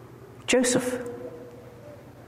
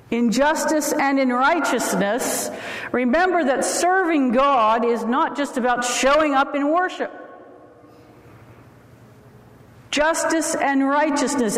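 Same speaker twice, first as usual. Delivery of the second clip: preaching at full force; 5 semitones higher than usual. Acted. Emphatic sermon run.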